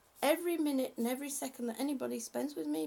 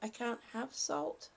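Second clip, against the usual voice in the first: ordinary talk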